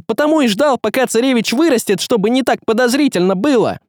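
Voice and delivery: high, urgent outburst; frantic argument. Acted. Young man exposing the sorcerer to everyone, frantic. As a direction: keep pressing the case high and breathlessly eager.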